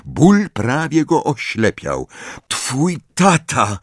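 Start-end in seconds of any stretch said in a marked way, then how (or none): 2.5-3.8 gasped out slowly, short of breath; panting. A narrator's reading. Harry gasping to Ron about his father, breathless. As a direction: force the words out between breaths. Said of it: "wydyszał".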